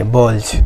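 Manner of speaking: बॉल्स with the plural S voiced, as "bolj"; final Z sound. Shown as a demonstration. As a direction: end बॉल्स on a buzz, not S. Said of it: "क्या पढ़ेंगे".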